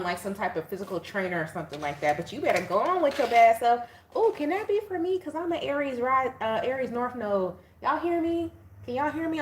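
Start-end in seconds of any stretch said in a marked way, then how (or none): 4.2-9.4 high, bright-eyed mock viewer asking if it's for her; eager viewer voice. Tarot viewer hoping the reading fits her, in a high eager voice. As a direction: squeal it like a hopeful fan.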